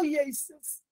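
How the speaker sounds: ordinary delivery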